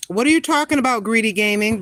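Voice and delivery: ordinary delivery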